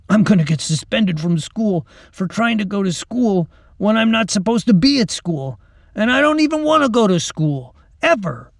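put-on worked-up kid venting to a friend; exasperated schoolboy. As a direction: pile up the absurd complaints, exasperated. Mac's ranting after laughing in dirt.

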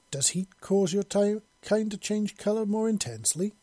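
lifted voice, naive wondering; innocently curious. A narrator's reading. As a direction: ask with open, guileless curiosity. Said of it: "asked in an innocently curious tone".

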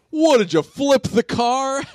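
high, slow, stunned disbelief; mock shocked Robert. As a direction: gape at the ruined pizza. Robert reacts to wrecked pizza.

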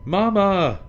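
high, loud call for Mama. Acted. Cub calling loudly for her lost mama. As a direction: call out to find her mother. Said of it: "Small Bear called".

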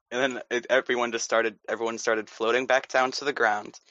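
normal talking voice

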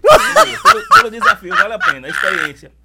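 loud, high laughing answer. Real, not acted. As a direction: burst out laughing as you say it. Repeats answer laughing loudly.

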